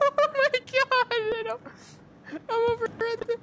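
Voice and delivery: nervous laughing squeal. Real, not acted. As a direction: squeak it out between nervous giggles. Scared in horror game.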